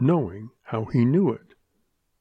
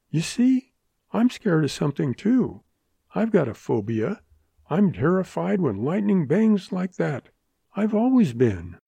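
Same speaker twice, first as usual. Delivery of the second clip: a higher voice with a touch of agitation; mildly rattled heroine admitting a phobia; lightly voiced June, confessing fear; 6 semitones higher than usual. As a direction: pitch it up, lightly flustered.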